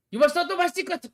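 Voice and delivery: very high, loud hammed-up cry; panicked shout. Blue caught out before stabbing a listener, hammed-up shout. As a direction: shriek it in mock alarm.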